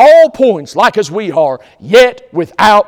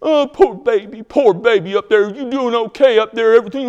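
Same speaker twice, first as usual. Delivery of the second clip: put-on sarcastic baby talk, a little higher; mock pity; 4 semitones higher than usual. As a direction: fake sympathy dripping with scorn. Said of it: "That's going".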